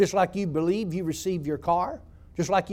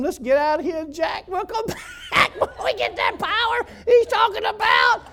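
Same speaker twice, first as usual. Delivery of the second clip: laughing panic; 13 semitones higher than usual. Retold or imitated. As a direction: laugh through a frightened joke. Laughing retelling wanting to flee.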